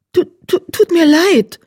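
high, flustered stutter on the first word; nervous, stuttering young cat; stammering. Acted. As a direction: trip over the apology, startled. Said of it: "stammelte Distelblatt".

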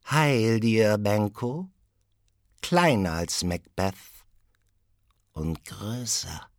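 high riddling witch greeting; lightly eerie witch. Acted. First witch riddling to Benko, lightly voiced. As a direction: tease him with a riddle.

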